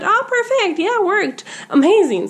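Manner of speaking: high-pitched, pleased little exclamation; mock-delighted self-talk, high and easy; acted delight. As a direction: play pleased relief, pitch lifted well up. Acts out delight at guidance working.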